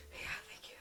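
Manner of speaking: breathless whispered thanks. Retold or imitated. Thanking the seatmate, whispered. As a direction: whisper it gratefully. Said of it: "And I was like".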